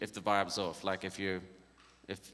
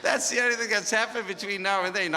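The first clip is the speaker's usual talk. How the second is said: talking through laughter; giggling as the words come out; high, breathless laughing talk; 9 semitones higher, 4 dB louder than usual. Real, not acted. Laughing through retold story.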